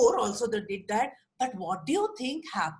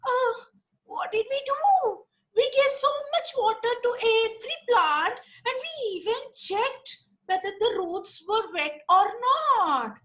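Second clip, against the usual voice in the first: much higher, small innocent monkey; little monkey voice; 10 semitones higher than usual. Acted. Little monkey defends itself to Viru, high and innocent. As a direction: pipe up like a tiny bewildered monkey. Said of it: "little monkey said".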